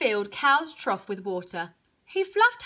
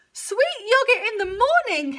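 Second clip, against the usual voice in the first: high pained cow exclamation; high cow outcry; bellowing cow; 9 semitones higher, 3 dB louder than usual. A narrator's reading. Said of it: "Cow bellowed".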